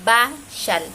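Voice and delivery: "Bashal" split loudly into ba, then shal; initial Maya B. Shown as a demonstration. Teacher asking learners to notice, "Bashal" sounded out loudly. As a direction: demonstrate "Bashal" with lips closed on the B. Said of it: "Así suena".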